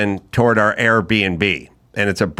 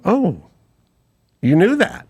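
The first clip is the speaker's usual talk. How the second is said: higher-pitched retold surprise; mock surprise; 8 semitones higher than usual. Teller checks what Mike knew, surprised. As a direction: act taken aback, voice lifted.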